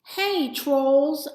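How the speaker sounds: high, loud call. A narrator's reading. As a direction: call out to get the trolls' attention. Calling out to the trolls.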